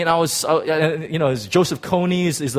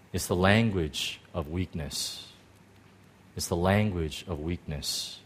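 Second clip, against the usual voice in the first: solemn hush; 7 semitones lower, 8 dB quieter than usual. Acted. Solemn repeated point.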